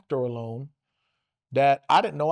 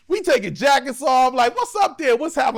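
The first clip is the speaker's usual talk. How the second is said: shouting; 6 semitones higher, 5 dB louder than usual. Retold or imitated. Squaring up to fight.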